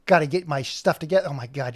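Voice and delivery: ordinary talk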